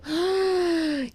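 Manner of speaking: a loud tearful gasp; sobbing gasp. Retold or imitated. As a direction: catch the breath as if crying. Mother sobbing behind the door. (suspiro alto de choro)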